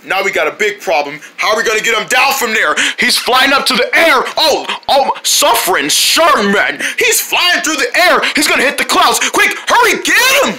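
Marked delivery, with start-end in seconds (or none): 3.0-10.6 urgent, rising high-pitched cries; panicked alarm. Acted. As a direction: raise the alarm, higher and higher. Alarm as friend flies away.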